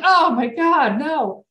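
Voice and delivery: high, loud "oh my God, no"; a sudden pained outburst; loud, high and alarmed; dismayed cry. Retold or imitated. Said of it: "I'm like".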